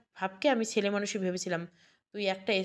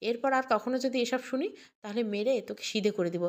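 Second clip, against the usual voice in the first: ordinary delivery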